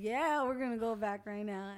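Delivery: loud, slow, all in; pumped agreement. Retold or imitated. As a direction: back him up, loud and slow. Joining the hype, retold.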